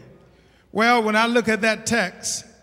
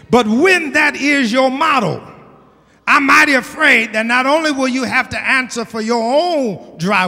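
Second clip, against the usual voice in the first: loud, raised, forceful sermon; high-powered exhortation with punched words; full-force preaching; 3 semitones higher, 6 dB louder than usual. Real, not acted. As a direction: preach a warning to the whole congregation.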